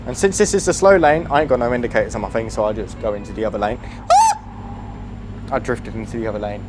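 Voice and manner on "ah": startled yelp. Real, not acted. Truck drifts into other lane.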